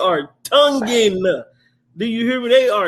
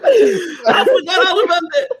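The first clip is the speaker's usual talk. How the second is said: laughing; 7 semitones higher than usual. Real, not acted. Friend laughing at memory.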